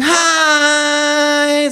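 high, loud, long drawn-out hi. Acted. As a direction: stretch the i like a dentist's drill. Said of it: "das langgezogene I ... eines Zahnarztbohrers". (schrilles, langgezogenes Hi wie ein Zahnarztbohrer)

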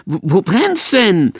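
slow, urgent question; anxious demand; alarmed. A narrator's reading. Couple asking the fireman where it burns, alarmed.

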